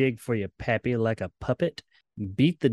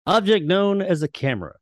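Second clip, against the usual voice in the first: higher, louder ominous announcer read; Twilight Zone narration; 7 semitones higher, 6 dB louder than usual. Shown as a demonstration. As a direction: intone it like the show's closing narrator. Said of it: "close us out, [name]".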